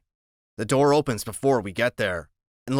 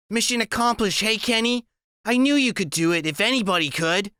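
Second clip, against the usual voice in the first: woman's voice; 10 semitones higher than usual. A narrator's reading. Loretta voiced by narrator.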